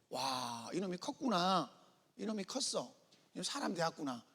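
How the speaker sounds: a quiet, touched mother's praise; proud mother, hushed and tender; softly pleased mother. Retold or imitated. Mother praises son in story.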